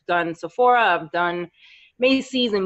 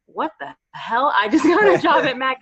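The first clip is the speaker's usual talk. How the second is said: retold amazement; 5 semitones higher than usual. New hire reacting to landing the job, amazed. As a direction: replay the stunned thrill of good news.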